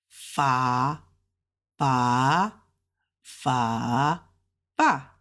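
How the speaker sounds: drawn-out low FA swoops, then a short falling FA; four tones in turn. Shown as a demonstration. Teacher attaches initial F for learners, tone by tone. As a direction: step FA through the tones. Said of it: "attach the initials onto it".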